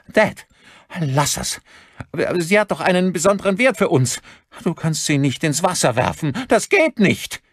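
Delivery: high, urgent begging; son's alarmed high protest; frantic pleading. A narrator's reading. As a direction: plead frantically, voice pitched right up. Son begs father not to throw.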